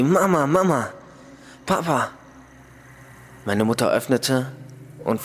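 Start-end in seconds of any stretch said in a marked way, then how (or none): 0.0-2.1 put-on a boy calling for his parents at the door; screaming for parents. A narrator's reading. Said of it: "und schrie".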